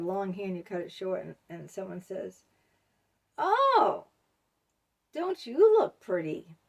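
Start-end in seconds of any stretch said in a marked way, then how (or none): 3.4-6.6 sarcastic compliment. Retold or imitated. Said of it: "it's all in the tone and the pitch".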